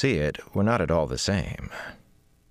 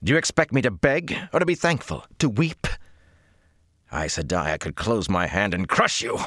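furious; 3 semitones higher than usual. Acted. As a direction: threaten her through rising anger. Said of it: "shook with fury".

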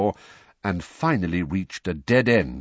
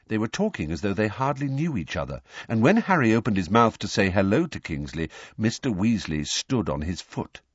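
ordinary delivery

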